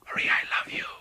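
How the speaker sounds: whispered declaration of love; hushed lover's stage whisper. Acted. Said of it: "But I'm whispering".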